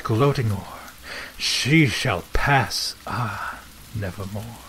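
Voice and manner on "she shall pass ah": ordinary delivery, nothing marked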